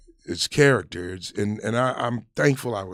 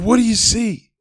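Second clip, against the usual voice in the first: much higher and louder drill sergeant demand; sergeant's bark; 10 semitones higher, 9 dB louder than usual. Retold or imitated. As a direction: bark the question at a fresh private. Sergeant barks at private.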